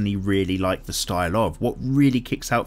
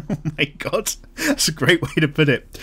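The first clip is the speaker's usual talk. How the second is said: laughing; 5 semitones higher than usual. Real, not acted. Laughs at comment.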